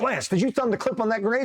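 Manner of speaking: high-pitched panicked questioning; mock accusation. Acted argument with buddy Lance.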